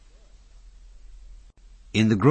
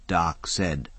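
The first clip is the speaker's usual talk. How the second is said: ordinary delivery, nothing marked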